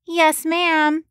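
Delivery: high, slow nasal Boston voice; thick Boston accent, pinched through the nose; a Boston producer on the phone, nasal and thick-accented. A narrator's reading. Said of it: "A nasally voice came through the line in a thick Boston accent".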